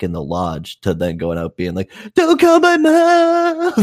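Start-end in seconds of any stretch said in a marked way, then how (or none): 2.1-3.7 very high, loud, drawn-out bawl; hammed-up sobbing plea; mock crying wail. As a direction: imitate a girl wailing in tears. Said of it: "this girl's out there crying".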